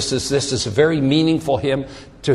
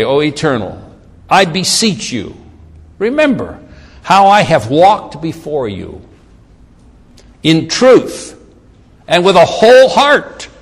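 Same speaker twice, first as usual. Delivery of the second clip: measured, emphatic plea to God; declaimed prayer. Acted. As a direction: beseech God phrase by phrase, with pauses. Reads Hezekiah's prayer aloud.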